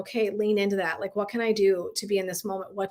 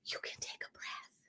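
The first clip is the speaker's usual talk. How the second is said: gentle breathy whisper, barely voiced; hushed, lovingly whispered reassurance; soft whisper; 22 dB quieter than usual. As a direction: whisper it like a soothing massage therapist. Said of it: "she lovingly".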